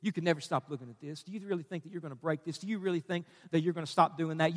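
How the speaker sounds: low, soft, quickened insinuation; tempting inner voice. Acted. Preacher voices temptation inside a struggling listener, low and quiet. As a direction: coax quietly, like a voice inside the head. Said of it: "there's this voice inside of you says".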